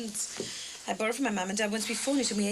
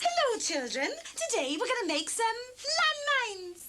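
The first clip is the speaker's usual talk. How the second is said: put-on bright, higher children's presenter voice; Floella Benjamin; 10 semitones higher than usual. Friend trying out a children's TV act as Floella Benjamin. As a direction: play a cheery kids' TV host, pitch rising on the punchline. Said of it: "You could do Floella Benjamin".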